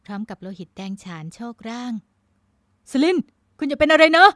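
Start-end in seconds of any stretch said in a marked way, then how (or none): 2.4-4.3 loud, high, desperate cry; frantic, high-pitched plea; panicked cry. Acted. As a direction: cry out to a wounded lover. Lover shot in his arms.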